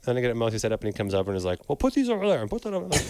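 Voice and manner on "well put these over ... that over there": high, bossy mock order; bossy imitation. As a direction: lightly play the bossy boss. Imitating Tim bossing.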